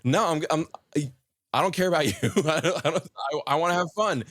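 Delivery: put-on high whiny child voice, half laughing; mock bratty child protest; defiant kid. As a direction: pitch up like a stubborn little kid refusing. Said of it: "When the kid's like".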